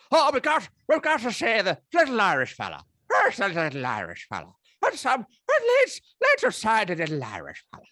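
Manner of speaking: high, loud Irish caller voice, hammed up; Irish accent. Imitating an Irish Leeds fan caller on the show, hammed-up Irish accent. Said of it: "So I'm gonna do the voice".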